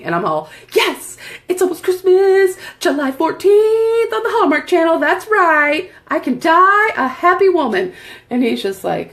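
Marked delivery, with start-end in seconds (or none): 0.7-7.9 high, loud, gushing; high loud gushing to her husband; giddy excitement. Retold or imitated. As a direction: bubble over with Christmas joy. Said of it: "You're loud".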